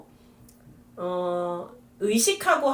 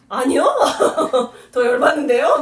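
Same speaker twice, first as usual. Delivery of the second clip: 어이없다는 듯 크게 터뜨리는 반문; mock indignant child; 5 semitones higher, 10 dB louder than usual. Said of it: "뭐 이런 이야기들을 물어보면". (웃으며 화난 척 크게 따지는 말투)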